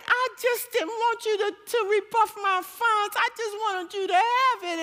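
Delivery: high, flustered blabbering. Retold or imitated. Explaining the money to the crying woman, blabbering. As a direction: babble an embarrassed excuse in a high voice. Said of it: "I was just talking and I was blabbering".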